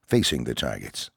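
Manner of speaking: ordinary delivery, nothing marked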